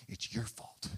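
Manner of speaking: a hissed whisper of accusation; whispered blame. Retold or imitated. David's men blaming him, whispered accusation. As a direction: breathe the blame out under your voice.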